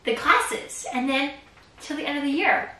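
ordinary talk

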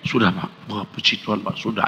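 low, very soft reply; wife's humble answer. Acted. Wife assures the pastor her husband has changed, hushed and low. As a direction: answer softly and low, almost under the breath. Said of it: "Kalau jawabnya begini".

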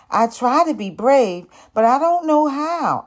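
put-on high, tearful plea of a scared boy. A narrator's reading. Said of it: "I say with tears in my eyes".

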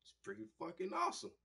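high, fast, thrilled; excited. Retold or imitated. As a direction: gush like a kid. Excited reaction, much higher.

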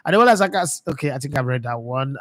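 ordinary talk

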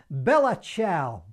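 "Bella Ciao" loud and drawn out, Australian accent; Australian-accented Italian. Real, not acted. Sax teacher announcing the Italian song title, "Bella Ciao" in broad Australian pronunciation. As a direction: butcher "Bella Ciao" slowly and loudly in Australian English sounds. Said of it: "butchering that with my Australian accent".

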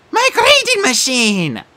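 loud anguished wail; distressed cry. Acted. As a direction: cry over the broken machine. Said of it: "she cried".

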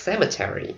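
"Cemetery" in four syllables, CE-me-te-ry with a full te syllable; American "Cemetery" with a clear Terry ending; American pronunciation. Shown as a demonstration. Teacher showing students the American "Cemetery" in four syllables. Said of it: "Mỹ đọc là Terry".

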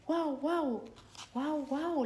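high barking woofs; dog bark. Acted. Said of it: "ladra, ladra". (ladrido agudo)

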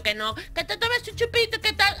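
high, slow, insisting again; pushy bar owner. Retold or imitated. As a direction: wheedle her into the shot. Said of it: "Y yo". (voz aguda e insistente)

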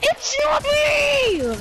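high, drawn-out screamed denial; frustrated scream. Real, not acted. Accused player told "I heard you", frustrated scream. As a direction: shriek it and stretch the last word.

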